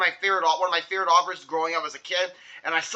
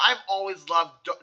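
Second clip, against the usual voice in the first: plain reading voice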